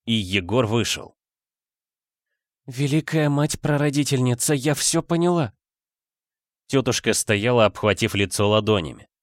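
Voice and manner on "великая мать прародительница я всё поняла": higher-pitched voice of a shocked woman; distressed aunt. A narrator's reading. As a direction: voice the aunt's dismayed realisation. Aunt's horrified realisation, voiced by narrator. (потрясённая тётушка, высоким голосом)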